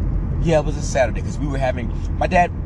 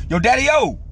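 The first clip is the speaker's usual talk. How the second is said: put-on pleading kid; 10 semitones higher than usual. Boy pleads with his pops at the fish market, high and eager. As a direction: beg like an excited boy. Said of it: "I used to call my pops Dad".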